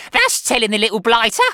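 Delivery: high, very loud gloating screech; excited harpy's shriek. A narrator's reading. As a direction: screech with spiteful approval. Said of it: "shrieked Missus Pratchett".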